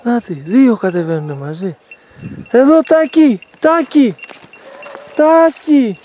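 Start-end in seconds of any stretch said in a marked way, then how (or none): 2.5-6.0 high, loud call across the field. Real, not acted. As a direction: call the dog over from far off. Calling the dog from afar.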